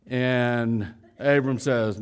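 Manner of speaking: normal talking voice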